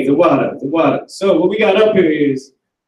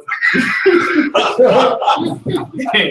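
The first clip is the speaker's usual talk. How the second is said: burst of laughter; 8 semitones higher than usual. Real, not acted. Laughing at the teasing about his accent. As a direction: crack up at the remark. Reacting to a joke.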